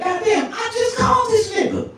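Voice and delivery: high shouting woman's voice; woman on the phone. Retold or imitated. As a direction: squeal it out like a furious woman. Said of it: "She like".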